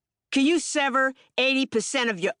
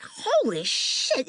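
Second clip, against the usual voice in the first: drawn-out shocked curse; slow stunned exclamation; 4 semitones higher, much slower than usual. Retold or imitated. Seeing the man bent up by police, slow shock. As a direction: let it out slowly, stunned. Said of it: "I'm like".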